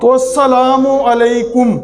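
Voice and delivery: usual speaking voice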